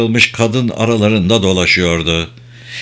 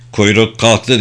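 plain reading voice

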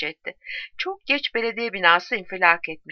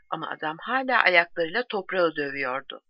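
plain reading voice

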